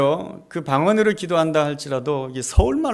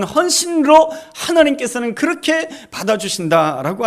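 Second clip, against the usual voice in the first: higher, louder sermon climax; preaching; 4 semitones higher, 4 dB louder than usual. Acted. 설교자가 회중에게 외치듯 설교. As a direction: preach it with rising force.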